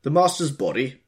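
loud high roar into the phone. A narrator's reading. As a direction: bellow it down the mouthpiece. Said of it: "roared the butler".

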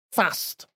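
short flat a in "Fast"; northern "Fast". Shown as a demonstration. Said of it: "There's no R in it".

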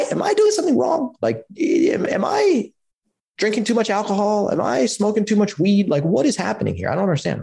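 retelling his younger self's frantic confusion; mock panic. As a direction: squeak out the worried questions. Retells panicked self-questioning.

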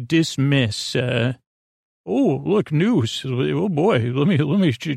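ordinary delivery

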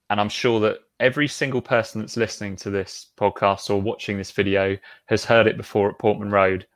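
usual speaking voice

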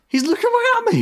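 very high mock-panicked cry; mimicked disbelief. Imitating the caller watched from the garden, incredulous. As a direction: squeal it in hammed-up disbelief. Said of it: "When he can't believe it".

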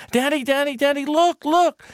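put-on high, rising, loud child's cry; high thrilled kid calling; excited little boy. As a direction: shout to wake dad up. Boy wakes father, sees bears.

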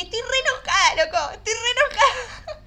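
high, loud, half-laughing cry; screaming into a pillow at the therapist's urging; mock pillow scream. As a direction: yell out the anger, high and loud. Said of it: "grité de una manera".

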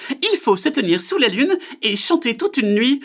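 very high, loud bird voice; know-it-all magpie. Acted. Magpie fools the frog, high loud bird voice. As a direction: squawk it out as a magpie.

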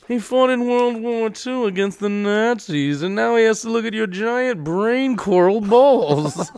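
slow, mock-refined indignation; prim, measured complaint breaking toward laughter; scandalized club member. Club member defending the war veteran father, mock-refined indignation.

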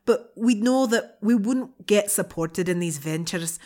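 hammed-up Scottish-sounding brogue; attempted Irish accent. Co-host reading a student's letter about moving abroad, attempted Irish accent. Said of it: "That's a brilliant Scottish".

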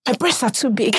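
coy humblebrag, breaking into laughs; mock coy boast. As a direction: fake modesty, giggling through it. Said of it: "this is one that women do a lot".

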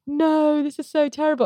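high-pitched melodramatic lament; mock dismay. Reviewer mimicking everyone in the film, in mock dismay. Mocking the characters' distress over Wallace.

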